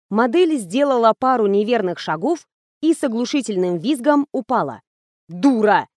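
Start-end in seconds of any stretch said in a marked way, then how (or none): none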